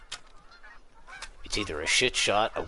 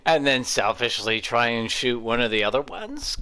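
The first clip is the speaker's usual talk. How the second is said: laughing; 4 semitones higher, 6 dB louder than usual. Real, not acted. Laughing through the question.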